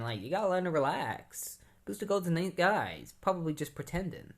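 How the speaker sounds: lightly voiced, breezy Guy Gardner. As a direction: play him as easygoing and naive. Goofy Guy Gardner voiced in recap.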